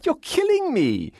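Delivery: piercing, delighted falsetto protest; shrill and gleeful. A narrator's reading. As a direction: protest in a very high squeal, loving it. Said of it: "Arthur's voice was shrill and gleeful".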